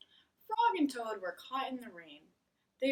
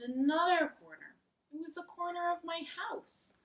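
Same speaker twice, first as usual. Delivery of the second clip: Frog's voice; 8 semitones higher, 4 dB louder than usual. Acted. Frog's story ending.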